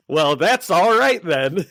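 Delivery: slow, hammed-up comic retort; comic put-on voice. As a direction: play it as a drawn-out joke voice.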